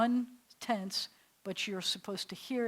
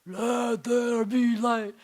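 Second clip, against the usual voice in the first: slow, much louder mock boom; booming God voice; 3 semitones higher, 10 dB louder, much slower than usual. Teacher mocks the loud booming God voice she rejects. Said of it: "It doesn't sound like".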